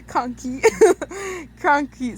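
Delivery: real laughter breaking up the word; laughing at flub. Real, not acted. Narrator cracking up after stumbling on a word, laughing. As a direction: laugh through the flubbed word.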